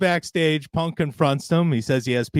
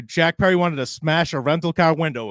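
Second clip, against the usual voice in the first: usual speaking voice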